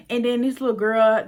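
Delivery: ordinary talk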